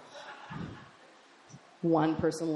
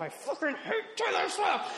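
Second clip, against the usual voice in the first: very high, strained rant; mock outraged shriek; 12 semitones higher than usual. Stoned driver reacting to Taylor Swift, mock outraged shriek. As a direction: shriek at the radio in comic disgust. Rants at the car radio.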